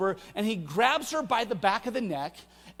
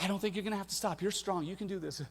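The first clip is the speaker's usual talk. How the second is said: reassuring dad; 8 dB quieter, faster than usual. Retold or imitated. Dad reassures worried daughter.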